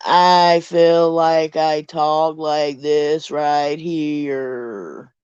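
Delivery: very slow, stretched-out Southern drawl; exaggerated Southern drawl. Shown as a demonstration. Mocking her own Southern speech, drawling it out slowly. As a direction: draw every word out in a long Southern lilt. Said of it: "I draw out so Southern".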